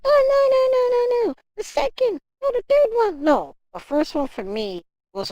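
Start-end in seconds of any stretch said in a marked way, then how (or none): 0.0-3.2 mocking the fans. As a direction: mimic a fan correcting you, mock-pleading. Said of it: "I know many people are like".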